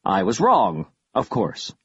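plain reading voice

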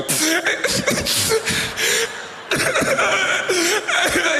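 high wailing sob with laughter; exaggerated blubbering; mock wailing sob. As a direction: collapse into a hammed-up cry. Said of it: "and you just go".

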